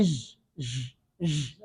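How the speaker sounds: ژ buzzed low and soft as a French zh; a hushed, buzzing ژ; wrong zha sound. Shown as a demonstration. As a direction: imitate the learner's mistaken zha. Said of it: "یہ ژا کی آواز نکالتا ہے".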